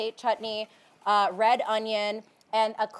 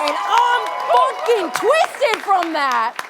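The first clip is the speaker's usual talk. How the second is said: excited; 8 semitones higher, 8 dB louder, much slower than usual. Real, not acted. Excited brag about sellout.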